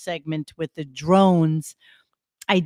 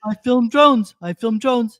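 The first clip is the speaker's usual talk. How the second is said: louder mocking of the governor; 2 semitones higher, 5 dB louder than usual. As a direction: taunt him like a proud fool. Mocking governor filming stars.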